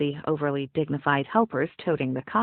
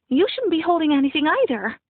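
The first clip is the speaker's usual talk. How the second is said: panicked; 10 semitones higher, 5 dB louder than usual. A narrator's reading. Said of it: "She looked panicked".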